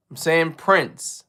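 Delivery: slowly, word by word; slow, deliberate. Shown as a demonstration. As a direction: spell it out slowly for the listeners. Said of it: "say it with the last word I said".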